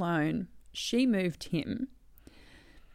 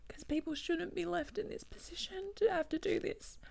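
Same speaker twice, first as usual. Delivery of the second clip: high, soft, voice close to breaking; tearful protest; 9 semitones higher, 5 dB quieter than usual. Real, not acted. Protesting what families are left to do, tearful. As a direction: push the words out through tears. Upset about what she had to do.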